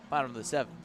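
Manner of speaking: ordinary talk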